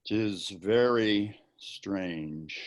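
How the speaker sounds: very slow, drawn-out musing; stretched out, lost in thought; slow wonder. Acted. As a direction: let each word hang in wonder. Wonder at ghost report.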